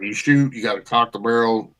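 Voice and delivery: ordinary delivery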